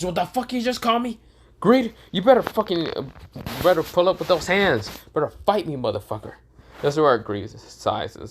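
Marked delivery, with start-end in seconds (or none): 0.0-6.3 high, angry challenge to fight; furious outburst. Acted. Iten challenging Greed after being called an idiot, furious. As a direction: bristle and call him out, pitched way up.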